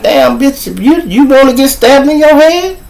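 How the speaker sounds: high-pitched mock disbelief; incredulous outburst. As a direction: exclaim it way up high, as if appalled. Retelling his shocked warning to her.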